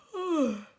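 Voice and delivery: yawn. Real, not acted. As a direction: yawn out loud mid-sentence. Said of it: "I keep yawning".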